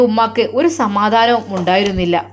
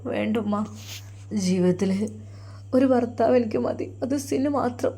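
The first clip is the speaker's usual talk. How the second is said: tearful; 7 dB quieter than usual. Acted. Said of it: "കണ്ണുനീർ ചാലിട്ടൊഴുകിക്കൊണ്ടിരുന്നു".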